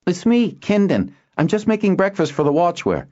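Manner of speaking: high-pitched call to the next room. Acted. Kindon answers the Harper in the next room, calling out. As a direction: call back to reassure someone out of sight.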